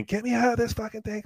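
mock the woman's panicked scream; high-pitched imitated scream; retold scream. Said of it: "and she's screaming".

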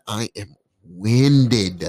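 very slow, stretched admission; drawn-out emphasis. Real, not acted. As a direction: drag out each word heavily. Admits he can't run.

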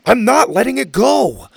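stubborn shout. Acted. Mac refuses while fleeing.

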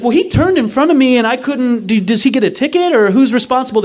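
high-pitched, indignant questioning; flustered protest. Retold or imitated. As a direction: act out pleading your case to police. Protesting to indifferent cops.

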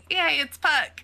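high-pitched little hooray; gleeful, high "yay"; playful cheer. Acted. Mock cheer at seeing Puck.

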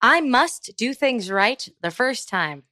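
slow, stilted robotic reading; robot learning to speak. Real, not acted. Co-host reading a quiz statement aloud, stilted robot. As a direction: read it word by word, slowly, like a machine. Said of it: "like I was a robot that was learning how to speak".

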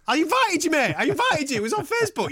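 high-pitched mimicry of an indignant friend; mock protest. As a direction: squeal it as a mock excuse. Mocking friend's excuse in retelling.

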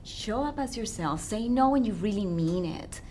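ordinary talk, nothing marked

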